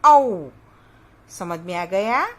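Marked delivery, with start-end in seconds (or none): 0.0-0.5 औ called out loud, round open au; औ, loud teaching pronunciation; clear vowel demo. Shown as a demonstration. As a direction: model the sound औ loudly for children. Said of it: "और उसका उच्चारण होगा".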